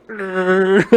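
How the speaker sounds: mock growl. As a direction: growl it like an annoyed dog. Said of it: "And they're just like".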